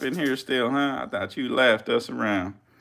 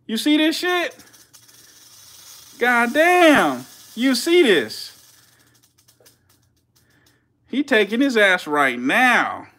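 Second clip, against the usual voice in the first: high, loud, slow astonished shriek; amazed shriek; 12 semitones higher, 7 dB louder, slower than usual. Real, not acted. To the stream, a high loud awed shriek at the strike. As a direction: squeal in awe, stretching the words. Snake seizes its prey.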